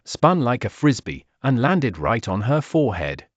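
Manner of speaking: ordinary delivery, nothing marked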